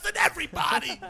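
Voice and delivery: high, loud exclamation; mock outcry. Storyteller voicing kids in a holding cell, mock outraged shout. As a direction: shout it like an aggrieved cellmate.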